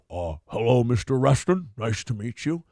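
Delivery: low, smooth Nixon greeting. Retold or imitated. Nixon voiced in a retold scene.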